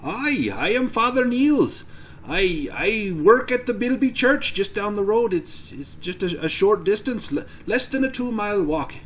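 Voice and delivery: put-on high-pitched Irish old priest; Irish accent. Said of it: "Why I sound Irish".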